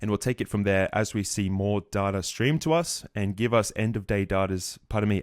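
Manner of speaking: ordinary talk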